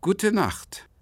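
kühl und abweisend, höher und lauter; frostig. Acted. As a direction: mit eisiger Höflichkeit verabschieden. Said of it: "sagte ich mit frostiger Stimme". (frostiges Gute Nacht)

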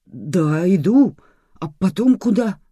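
high, loud bewildered reply; agree, then ask helplessly; lost man under pressure; flustered. A narrator's reading. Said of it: "Мужчина опять растерялся".

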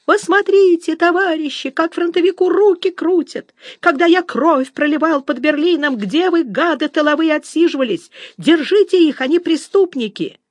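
high-pitched whining wail of outrage; nasal, drawn-out yell. A narrator's reading. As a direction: wail nasally to rouse the crowd. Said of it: "заорал гугниво и протяжно". (гугнивый протяжный крик)

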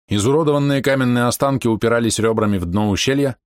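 usual speaking voice

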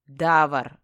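loud, forceful roar of a name. A narrator's reading. Mage calling for his apprentice, roaring. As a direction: bellow the name in fury. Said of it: "взревел я".

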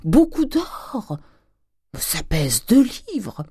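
slow, high gloating; exultant exclamation. A narrator's reading. Grandet weighing the gold, exultant exclamation. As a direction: stretch each word in miserly delight. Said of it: "s'écria-t-il".

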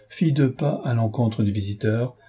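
ordinary delivery, nothing marked

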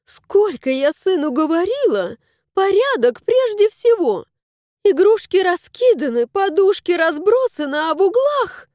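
high-pitched, mounting nagging; fussy housekeeping rant, pitched well up; scolding tirade. A narrator's reading. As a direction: scold with rising indignation. Mother-in-law's tirade about the mess.

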